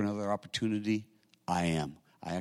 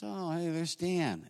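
higher, slow, lightly voiced friendly hello; gracious greeting; 9 semitones higher, 4 dB quieter, slower than usual. Older brother spots him at memorial, gracious slow hello. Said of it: "he was very gracious".

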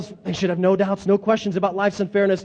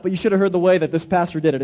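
normal talking voice

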